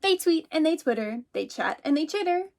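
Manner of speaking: a little mouse calling out, startled. Acted. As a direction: call out as if someone just knocked. Teacher reads picture book aloud.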